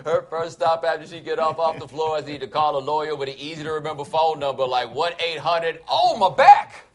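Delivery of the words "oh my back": high, loud hammed-up agony; mock pain cry. Faller phones a lawyer about injury, hammed-up pain cry. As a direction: yelp as if badly hurt, for laughs. Acts out a fall victim calling lawyer.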